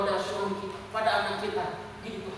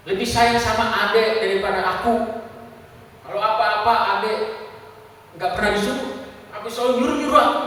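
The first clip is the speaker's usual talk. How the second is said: loud, emphatic mock grievance, building at the end; imitated child's complaint; 3 semitones higher, 8 dB louder than usual. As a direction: protest loudly like a resentful older child. Menirukan keluhan anak sulung yang kesal. (menirukan keluhan anak dengan suara keras)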